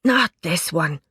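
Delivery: lightly hoarse, half-whispered; a hint of a hoarse whisper. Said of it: "whispered Da hoarsely".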